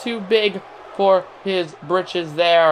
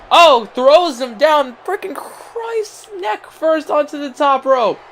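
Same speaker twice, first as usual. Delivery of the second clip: shocked outcry; 7 semitones higher, 3 dB louder, slower than usual. Real, not acted. Brutal throw onto rope.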